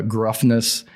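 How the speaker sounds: ordinary talk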